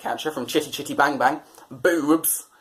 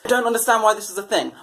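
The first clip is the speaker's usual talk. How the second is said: ordinary talk, nothing marked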